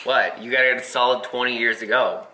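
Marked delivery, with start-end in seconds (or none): none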